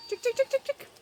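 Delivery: high singsong chick chick call; calling chickens. Real, not acted. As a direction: call the hens in, high and bright.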